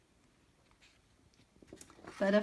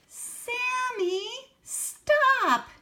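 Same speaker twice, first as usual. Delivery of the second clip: much higher, louder alarmed yell; children yelling; 10 dB louder, much slower than usual. Acted. The children yell at Sammy to stop, loud and high. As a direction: shout to stop him. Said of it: "yelled the children".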